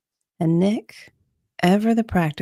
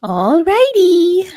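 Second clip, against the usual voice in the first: much higher, louder and slow, a big sing-song exclaim; hammed-up all righty; 9 dB louder, slower than usual. Cook to coworkers at closing, hammed-up high sing-song exclaim. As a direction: throw it out like the shift just ended. Katie pulls off her apron.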